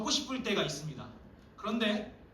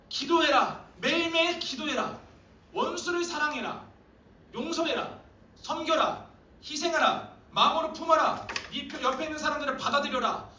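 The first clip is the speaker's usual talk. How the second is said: higher, louder string of commands; preaching commands; 7 semitones higher, 3 dB louder than usual. Acted. Preacher firing God's commands at the congregation, higher and louder. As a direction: hammer each command home.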